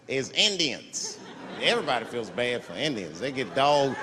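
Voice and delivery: ordinary talk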